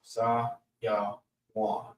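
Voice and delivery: Sa, ya, wan said softly with a pause between each part; syllable by syllable. Shown as a demonstration. Teacher splitting the word into syllables for the class. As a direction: break Sayawan into Sa, ya, wan, slowly. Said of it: "Three syllables".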